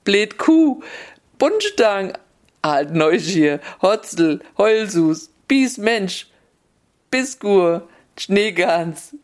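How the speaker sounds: hoch und atemlos lachend Schimpfwörter raus; lachend geschimpft. Acted. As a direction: zwei Frauen, die sich beim Schimpfen kaum halten können. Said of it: "die zwu musste dabei lachen". (lachend schimpfend, deutlich höher)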